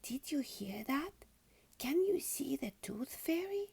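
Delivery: excited breathy whisper in the dark; a little girl whispering at night. Acted. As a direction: whisper it so George won't miss the fairy. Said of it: "she whispered".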